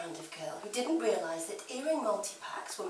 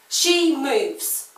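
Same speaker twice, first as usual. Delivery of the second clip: high, loud acted shout; shouted exclamation; 6 semitones higher, 17 dB louder than usual. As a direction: cry it out, pitched up and very loud. Acted exclamation about the model.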